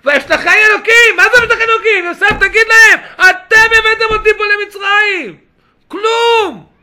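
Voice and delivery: high, shouted astonishment at the verse; full-force preaching. Acted. Preacher challenging Joseph to blame his brothers, full-force shouting. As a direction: cry it out to the whole room.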